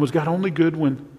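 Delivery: ordinary delivery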